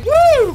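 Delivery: high, loud "woo"; gleeful high shout; whooping cheer. Real, not acted. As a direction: let out one big whoop. Cheering a kill.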